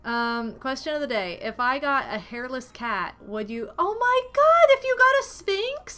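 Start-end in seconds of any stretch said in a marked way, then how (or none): 3.8-6.0 loud high oh my god, much higher; appalled squeal; shocked outburst. Real, not acted. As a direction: recoil in horror at the idea. Reacting to hairless cat.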